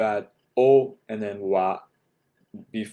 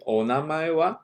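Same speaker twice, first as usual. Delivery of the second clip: higher voice, climbing on "wa"; going up at the end; 5 semitones higher than usual. Shown as a demonstration. Teacher showing what makes it a question, "wa" rising. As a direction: lift "wa" into a question. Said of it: "You're going up at the end".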